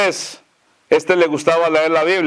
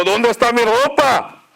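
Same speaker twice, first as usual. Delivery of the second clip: acted cry, pitched up at normal volume; mock shouting; 6 semitones higher than usual. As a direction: call out in alarm as a storyteller would. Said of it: "Y empezó a gritar".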